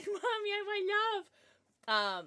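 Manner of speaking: high, slow whiny puppy voice; voicing the puppy. Owner voicing her dog asking for love, whiny. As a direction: whimper it as the needy dog. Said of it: "He said".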